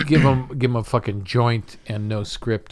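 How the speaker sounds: normal talking voice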